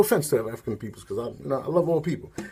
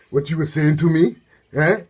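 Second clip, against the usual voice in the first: mock confrontation; 4 semitones higher, 7 dB louder than usual. Acted confrontation by rival.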